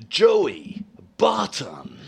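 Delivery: very slow, breathy movie-voiceover call; breathy announcer. Shown as a demonstration. Host trying to out-American the guest, breathy announcer. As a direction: draw out each name with lots of breath. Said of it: "I love how breathy you are".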